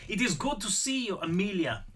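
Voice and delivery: put-on high-pitched old Austrian man; Austrian accent. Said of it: "Apparently I am doing the accent".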